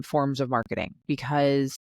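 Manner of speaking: ordinary delivery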